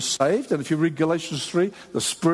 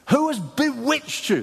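slow, high-pitched indignant demand; acted, incredulous anger; strong rebuke; 7 semitones higher than usual. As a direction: demand it like an outraged apostle. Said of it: "That's a strong word to use".

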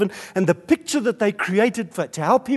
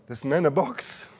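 usual speaking voice

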